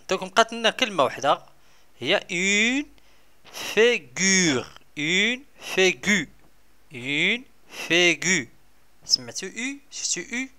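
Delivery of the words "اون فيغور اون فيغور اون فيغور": slow, drawn-out فيغور for pupils; teacher repeating فيغور slowly; slow modelling. Shown as a demonstration. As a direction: stretch out فيغور so children can copy it. Teacher models new word.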